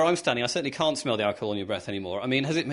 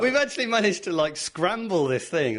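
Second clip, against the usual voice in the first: laughing, slowing as she talks; amused and giggling; 5 semitones higher than usual. Real, not acted. Host laughing at result.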